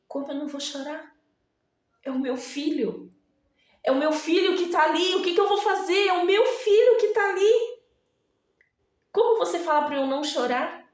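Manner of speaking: mais agudo e rápido, crescendo em angústia; desespero. Pretend. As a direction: grite a dor de mãe que perde o filho. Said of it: "ela poderia ter falado assim". (mãe desesperada responde a Jesus, voz aguda e crescente)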